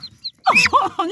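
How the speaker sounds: a sudden high startled laugh; nervous high laugh. Real, not acted. Filming her biting duck, a startled nervous laugh. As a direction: laugh out in fright as the duck lunges.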